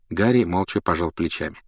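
plain reading voice

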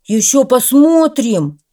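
the stung dog shouting after the bulldog; vexed shout. A narrator's reading. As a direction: yell it after him in frustration. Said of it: "крикнул ему вслед раздосадованный пёс".

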